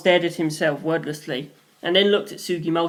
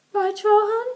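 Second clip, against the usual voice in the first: put-on much higher young woman's voice, gentle; Sugimoto's voice. A narrator's reading. Sugimoto teasing Rohan.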